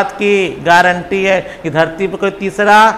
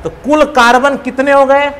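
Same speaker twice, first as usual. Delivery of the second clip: ordinary talk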